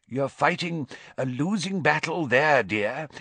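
wheezy mirror. A narrator's reading. Mirror commenting on Harry's hair, wheezy. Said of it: "said his mirror in a wheezy voice".

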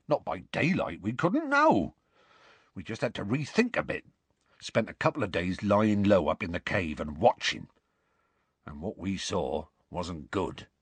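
rustic voice, sinking to a grave close; Hagrid explaining. Acted.

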